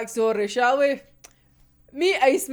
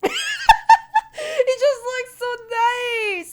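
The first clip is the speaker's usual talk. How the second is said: very slow, high, laughing sigh of delight; real giggly fangirl gush; 11 semitones higher, 3 dB louder, much slower than usual. Real, not acted. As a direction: melt over the drawing. Gushing over the art.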